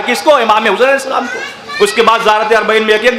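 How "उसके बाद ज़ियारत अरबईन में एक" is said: ordinary talk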